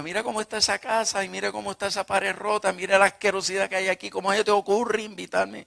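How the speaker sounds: mother scolding about the mess; indignant mother. Retold or imitated. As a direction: rattle off her disgust at the dirty house. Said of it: "Y ella me dijo".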